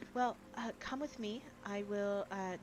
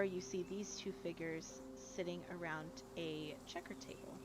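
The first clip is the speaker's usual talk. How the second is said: usual speaking voice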